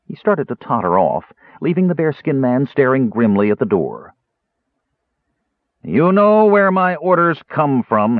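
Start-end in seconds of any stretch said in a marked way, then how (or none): 5.8-8.2 slow, raised, menacing warning; ominous. A narrator's reading. Bearskin man warning the jailer about orders, ominous. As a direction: draw it out as a threat. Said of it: "he said in ominous tones".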